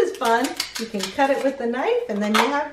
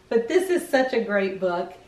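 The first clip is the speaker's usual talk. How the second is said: plain reading voice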